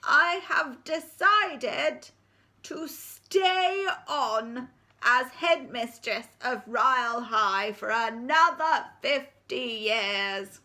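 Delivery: put-on creaky old headmistress announcing; ancient head teacher. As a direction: speak as a wobbly ninety-nine-year-old.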